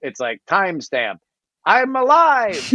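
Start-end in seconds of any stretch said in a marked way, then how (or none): 1.6-2.6 high squeaky relief, hammed-up; mock relieved robot. Kepler voiced, high.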